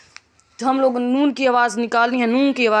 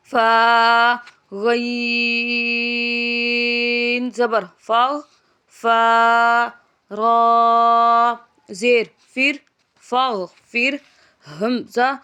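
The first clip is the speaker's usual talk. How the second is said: slow chant, letters drawn out then joined; Qaida spelling drill; much slower than usual. Shown as a demonstration. Teacher chanting letters for pupils, drawn-out sing-song spelling. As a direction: spell it out for a child learning Quran letters.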